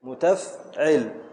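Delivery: "متفعل" drawn out slowly; slow scansion. Shown as a demonstration. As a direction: spell out the foot slowly. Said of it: "teacher scans foot slowly". (تقطيع بطيء)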